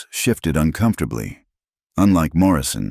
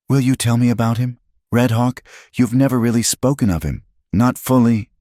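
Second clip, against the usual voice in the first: put-on a touch higher, quicker girl's voice; lightly voiced young Rosa; 7 semitones higher, 3 dB louder than usual. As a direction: Rosa gently asking, only lightly voiced. Young woman's voice.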